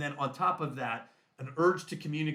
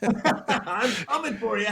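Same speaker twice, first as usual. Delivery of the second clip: slow, higher joke threat through laughter; laughing mock threat; 7 semitones higher, 4 dB louder, slower than usual. Listener riffing on the threatening script, laughing mock threat. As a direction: play the menace while cracking up.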